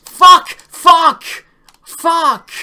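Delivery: loud, high raging yell; furious scream. Real, not acted. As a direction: scream it out in fury. Run lost at the very end.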